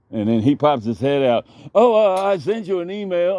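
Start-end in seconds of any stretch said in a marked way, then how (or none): 1.7-3.4 imitating the man.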